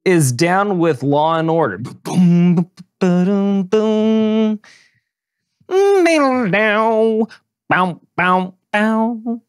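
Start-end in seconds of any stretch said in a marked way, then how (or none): 1.8-4.6 imitated sting. Imitating Law and Order sting. 5.7-9.4 mock theme sounds.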